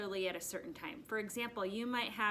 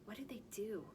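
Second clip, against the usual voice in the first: a hushed question to pull them in; whispered question; 8 dB quieter than usual. Shown as a demonstration. Teacher whispering the question to the first graders. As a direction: whisper it like a secret. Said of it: "The verb is the action part".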